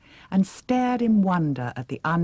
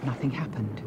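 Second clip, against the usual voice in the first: ordinary delivery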